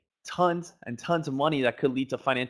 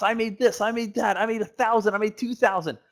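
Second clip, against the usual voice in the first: imitated bragging, pitched higher; mock boasting; 6 semitones higher than usual. Traders throwing out big profits to others, mock boasting. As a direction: rattle off brags as other traders would.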